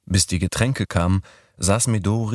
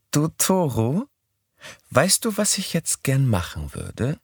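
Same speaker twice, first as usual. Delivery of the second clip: put-on higher young woman's voice; Midori's voice; 8 semitones higher than usual. A narrator's reading. Narrator voicing Midori proposing Sunday, higher voice. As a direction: pitch up for the girl.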